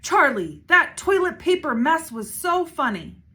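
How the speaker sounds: excited shout across the kitchen. A narrator's reading. Little girl shouting gleefully to Charlie. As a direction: call it out loud and high. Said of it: "Carla shouted".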